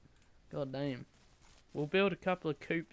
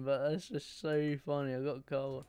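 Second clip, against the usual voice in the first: normal talking voice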